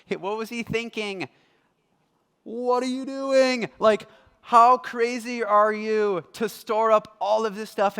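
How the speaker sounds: loud, high mocking self-righteous rant; smug scorn. Preacher mimicking smug listeners scorning the rich man, loud and sneering. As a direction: sneer at the fool, sure you're better. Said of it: "basically like".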